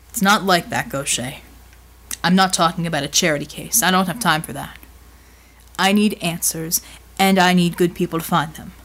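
firm, low-pitched rebuttal; big man, firm. Acted. Voices Bulwark answering Mel.

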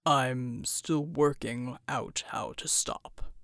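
very low, slow, strained rumble; deep slow voice. A narrator's reading. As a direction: drag it out, deep and heavy. Bull's voice.

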